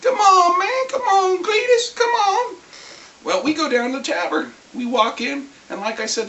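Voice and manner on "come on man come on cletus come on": higher and louder, pleading, near crying; wheedling, rising into a whiny plea. Retold or imitated. Bard pleading with his friend, high and near tears. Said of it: "that bardic tongue coming out".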